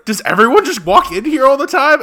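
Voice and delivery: loud, high retold complaint; mock exasperation. Claudette objects to people walking in, loud mock exasperation.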